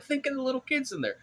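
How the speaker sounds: hammed-up voice begging for the poor kids; mock pleading ad. Ad campaign plea, retold mockingly.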